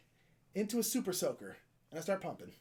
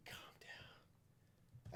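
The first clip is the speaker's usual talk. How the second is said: whispered calm down; 25 dB quieter than usual. Retold or imitated. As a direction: hush him in a soft whisper. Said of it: "And I was just like".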